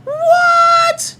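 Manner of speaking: high, loud disbelieving what; shocked shout. Real, not acted. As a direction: shriek it in disbelief. Reacting to chat.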